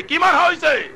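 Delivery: high call for a response; rousing preacher's prompt; shouted question. Real, not acted. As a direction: fire the question high at the congregation.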